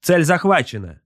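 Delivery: high-pitched gunnery slave reporting; crew report. A narrator's reading. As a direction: call out readiness in a high voice. War slave reports target lock.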